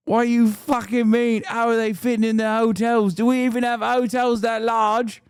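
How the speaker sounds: high-pitched mock British panic; outraged British woman. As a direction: mimic a scandalized British lady in disbelief. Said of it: "I think she would die on the spot".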